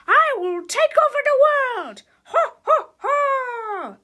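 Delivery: loud, high megalomaniac shout; hammed-up evil cackle; evil villain. A narrator's reading. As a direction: proclaim it and burst into villain laughter. Villain proclaims world takeover.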